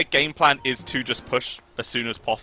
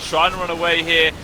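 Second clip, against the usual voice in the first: drawn-out, raised casting for the finish; climactic play-by-play; 8 semitones higher, 4 dB louder than usual. Real, not acted. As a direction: build to the game-winning moment. Live esports play-by-play, game ending.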